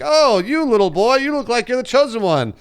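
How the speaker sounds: high, slow sneering wizard voice; mock the kid in a high drawn-out voice; mock Ollivander. Ollivander mocking a kid in line, high and sneering. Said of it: "I'd be like".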